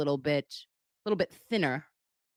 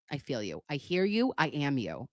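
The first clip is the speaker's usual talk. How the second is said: ordinary delivery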